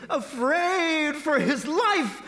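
high, slow, hammed-up fear; afraid. A narrator's reading. Narrator describes captives fearing for their lives, mock fear. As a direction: stretch it out high in mock terror.

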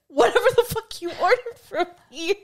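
a friend's blunt reply, retold through laughter; laughing quote. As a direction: quote him while cracking up. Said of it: "And he's like".

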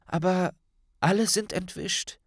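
a hint of a worried schoolgirl; young Hermine voice. A narrator's reading.

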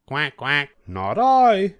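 a duck quacking then refusing. A narrator's reading. Duck refusing to help the hen in a high voice. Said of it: "said the duck".

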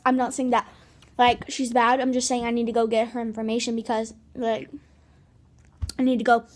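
talking around gum wad; chewing gum while talking. Real, not acted. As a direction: mouth full of gum. Seen 1: Cheek bulges at 4.2; gum unwrapped and mouthed in references.

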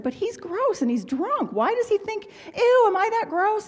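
high, disgusted self-questioning; high-pitched dismay, retold. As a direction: replay your appalled protest, pitch jumping up. Retelling her own disgusted protest.

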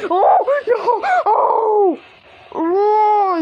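high, loud startled shrieks; terrified screaming. Real, not acted. Player caught by the monster, terrified screaming. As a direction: shout out in sudden terror. Jump scare in the game.

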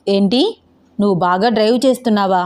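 ordinary delivery

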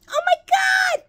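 high, loud delighted squeal; squealing with real joy over the crow; excited squeal. Real, not acted. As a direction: shriek with delight at the find. Unboxing a crow chalice, squealing.

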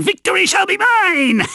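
high, swelling triumphant cry; gloating villain. Acted. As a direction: crow it like a cartoon villain. Mascot gloats about coming victory.

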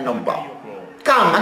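usual speaking voice

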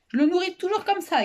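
put-on high little girl's voice; high-pitched kid telling how it's done; girl explaining. A narrator's reading. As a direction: explain it high and pleased, as a child.

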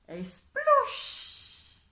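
high, loud splash sound effect; explosive storybook splat; splat noise. A narrator's reading. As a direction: let the splush burst out with a long hiss. Narrator imitates the dropping landing.

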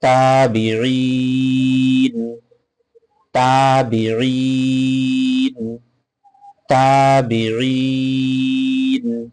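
তাবিঈন with ঈ stretched three alifs and a silent ন at the stop; pausal recitation, long madd. Shown as a demonstration. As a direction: hold the long vowel, stop on sakin. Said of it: "সেটা অবশ্যই সাকিন করে ফেলবো".

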